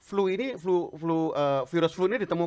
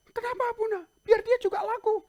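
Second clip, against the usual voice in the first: much higher, louder pleading voice; protesting friend; 7 dB louder than usual. Retold or imitated.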